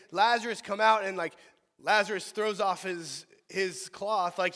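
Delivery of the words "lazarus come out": calling out. Retold or imitated. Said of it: "Jesus doesn't say".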